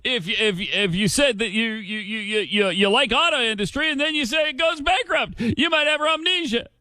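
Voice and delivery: high, stuttering parody of a stumbling speaker; mock stammer. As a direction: stumble on every word to mock him. Mocking Obama's stammering riff.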